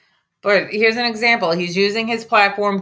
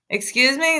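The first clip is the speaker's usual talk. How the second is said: indignant excuse me; 7 semitones higher than usual. Real, not acted. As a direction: demand he repeat it. Repeated indignant reaction.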